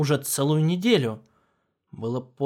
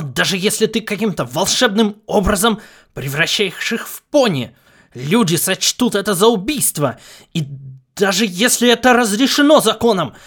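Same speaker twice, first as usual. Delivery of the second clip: loud, higher, heated tirade; furious; 6 semitones higher, 10 dB louder than usual. A narrator's reading. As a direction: rant at the AI in outrage. Lars argues furiously.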